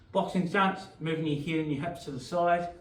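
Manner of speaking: ordinary talk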